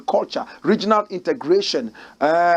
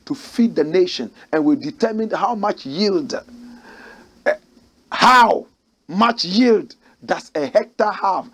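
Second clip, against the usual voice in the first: ordinary talk, nothing marked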